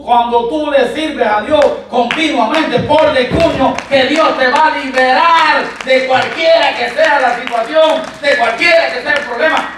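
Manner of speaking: high, driving pulpit shout; loud, high, insistent exhortation; full-force preaching. Real, not acted. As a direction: preach it hard to rouse the congregation.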